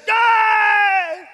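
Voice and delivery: high, loud drawn-out cheer; crowd cheer. Acted. Kids cheering the fixed bus, high shout. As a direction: whoop like a delighted busload.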